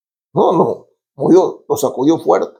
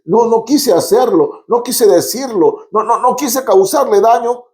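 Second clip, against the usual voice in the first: higher, protesting denial; defensive excuse; 8 semitones higher than usual. Retold or imitated. Preacher mimicking a guilty listener's higher, protesting excuse. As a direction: insist you never meant it, pitched up. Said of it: "usted dice".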